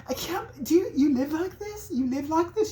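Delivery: high, appalled British lady voice; mock British woman aghast at the filth. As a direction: gasp it in a posh British woman's voice. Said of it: "She's, like, British".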